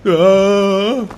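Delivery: sharp high yelp of fright; jolted, short loud cry; startled yelp. Real, not acted. Player startled by something in the game, sharp yelp.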